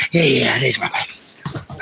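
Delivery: soft slow doting coo; tender murmur. Real, not acted. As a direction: settle down and dote on the pup. Tender with his dog.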